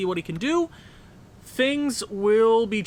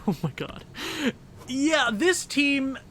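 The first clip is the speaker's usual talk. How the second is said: laughing through words; 6 semitones higher, 3 dB quieter than usual. Real, not acted. Laughing at own slip.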